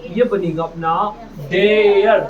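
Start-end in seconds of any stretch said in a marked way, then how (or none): 1.5-2.2 डेयर said loud as dare; डेयर, louder, one syllable. Shown as a demonstration. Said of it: "ड लगाओगे".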